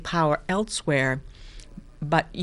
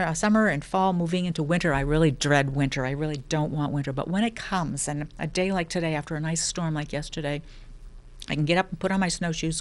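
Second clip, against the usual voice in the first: ordinary talk, nothing marked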